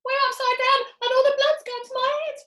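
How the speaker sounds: high, loud panicked screech; screaming Missus Twit. Acted. Missus Twit screams that blood rushes to her head. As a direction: wail it like she's dying. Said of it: "screamed Missus Twit".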